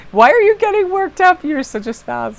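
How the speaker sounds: high, incredulous telling-off; mock self-scolding. Driver chiding herself for getting worked up, mock exasperated. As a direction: start high and loud, then drop, playfully scolding. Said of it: "I got so mad".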